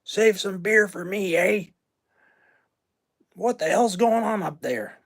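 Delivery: cranky Canadian father, high and cartoonish; cartoon dad voice. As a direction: do the grumpy movie dad calling down. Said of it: "Mel Blanc voiced the father of Bob and Doug McKenzie".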